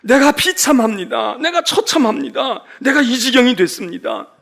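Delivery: loud lament. Retold or imitated. Preacher voicing the lamenting people, loud anguished lament. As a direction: cry out the misery from the pulpit. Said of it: "애가서에 얘기합니다".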